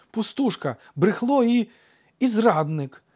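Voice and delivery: high, loud jibes with the edge taken off; blurted childish name-calling. A narrator's reading. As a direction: blurt out insults, without real aggression. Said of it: "Не втримався і Фікл, але в голосі його вже не було колишньої агресії". (не втримавшись, дражниться без злості)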